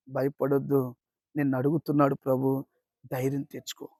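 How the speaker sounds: tender reassurance. Acted. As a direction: hush down to comfort a frightened child of God. Preacher comforts a fearful listener.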